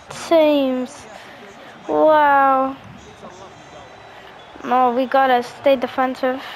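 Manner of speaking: normal talking voice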